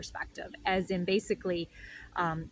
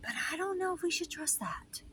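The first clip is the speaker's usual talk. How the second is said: higher, whispered hesitation; doubtful whisper; 10 semitones higher, 5 dB quieter than usual. Retold or imitated. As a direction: breathe it out like a wary conspirator. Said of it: "my ego is just like".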